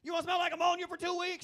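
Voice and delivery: loud high exasperated yell; exasperated shout. Retold or imitated. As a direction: shout it out, fed up. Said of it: "I'm like".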